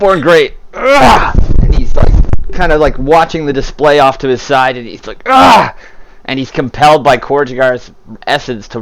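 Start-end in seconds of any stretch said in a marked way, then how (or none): 0.7-1.3 strained "ugh", louder and higher; resisting grunt. Acted. Born Great being taunted, strained resisting grunt. 5.2-5.7 loud, high strained "ugh"; resisting grunt. Acted. Born Great compelled to run, loud strained grunt.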